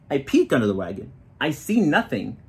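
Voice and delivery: ordinary delivery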